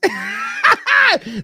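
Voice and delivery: loud, high shriek of a laugh; loud burst of laughter. Real, not acted. Dad laughs at his joke about the boyfriend, loud. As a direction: crack up loudly at the own joke.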